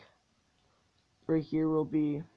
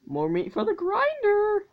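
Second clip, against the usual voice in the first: high, loud hammed-up villain gloat; mock villain; 5 dB louder than usual. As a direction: gloat like a cartoon warlord. Villain line in game.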